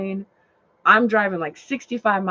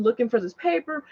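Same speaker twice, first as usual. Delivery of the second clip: ordinary talk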